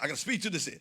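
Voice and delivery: normal talking voice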